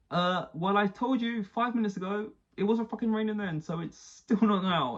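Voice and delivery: mock him as a smug know-it-all; snide Kravitz. Mocking Kravitz snapping at Crofty, high and sneering.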